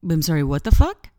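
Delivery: loud, startled question with a rising end; mock-stunned disbelief; incredulous. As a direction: blurt it out in disbelief. Retold startled reply to the voice.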